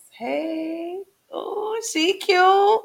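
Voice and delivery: playful self admiration. Real, not acted. Host admires herself on camera, high and cooing. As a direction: coo at your own reflection.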